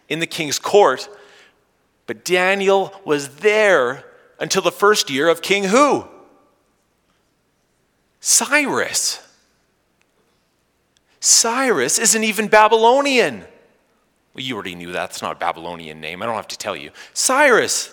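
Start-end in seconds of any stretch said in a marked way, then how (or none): 2.1-13.5 high, loud burst of disbelief; mock amazement. Preacher to congregation on a king's odd name, mock amazement. 17.1-17.9 mock amazement. Joking repeat of the surprising name.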